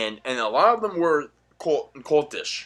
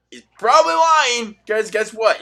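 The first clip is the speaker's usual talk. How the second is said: louder, higher ranting; heated; 7 semitones higher, 6 dB louder than usual. Real, not acted. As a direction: push it with anger. Heated rant.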